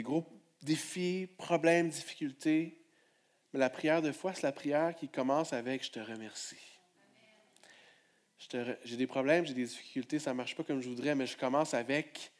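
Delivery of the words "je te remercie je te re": low, hushed thank-you; soft prayer. Acted. Preacher voices Jesus' prayer to the Father, hushed. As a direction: breathe it like a prayer.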